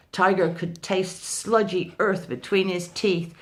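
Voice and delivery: ordinary delivery, nothing marked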